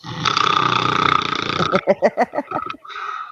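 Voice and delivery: long rattling snore, then laughter; loud snoring. Retold or imitated. Friend passes out drunk during the film, snoring. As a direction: imitate a chainsaw snore and crack up. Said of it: "running a fucking chainsaw out of his nose".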